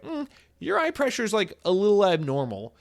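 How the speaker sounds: a hesitant doctor breaking mild news; imitating doctor. Quoting the new eye doctor to viewers, high hesitant hum.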